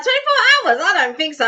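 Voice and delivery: high, loud retold disbelief; incredulous retort. Co-host recalling talk of a day-long live, incredulous retort. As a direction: recall your reaction with a mock outraged squeak.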